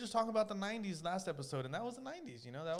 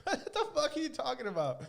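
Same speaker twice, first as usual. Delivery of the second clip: incredulous; 8 semitones higher, 3 dB louder than usual. Retold or imitated.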